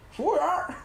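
high Dongbei-style call for the waiter; Northeastern waiter call. Shown as a demonstration. Storyteller shows how the woman called out, high Dongbei call. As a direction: demo the Northeastern shout for the waiter. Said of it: "就是这样".

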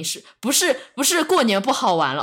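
ordinary talk

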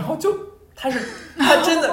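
high, loud laughing through the words; real laughter breaking the telling. Real, not acted. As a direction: crack up mid-story. Laughing while retelling the story.

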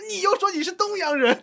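high loud stress on 又; mocking accusation. Colleague mocking again.

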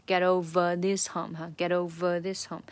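ver of over punched; stress on over. Shown as a demonstration. Teacher repeating the stressed over for learners. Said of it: "这个ver是一个重读的音节".

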